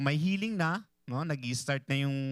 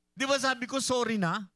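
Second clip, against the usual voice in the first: much higher, irritated "sorry na"; annoyed apology; 10 semitones higher than usual. Acted. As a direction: snap it out like an angry spouse. Acted spouse quarrel, annoyed apology. (naiinis na paghingi ng tawad)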